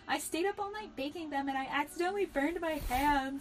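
lightly hammed-up sob story; plaintive pleading. A narrator's reading. Pleading character in story read-aloud.

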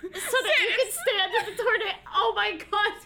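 high, incredulous laughter; cracking up in disbelief; laughing. Real, not acted. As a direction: exclaim through real laughter. Listener cracking up at the story.

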